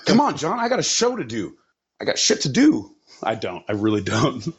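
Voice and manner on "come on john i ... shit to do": pitched up, pleading to get going; impatient urging. Real, not acted. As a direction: hurry him along, half-whining.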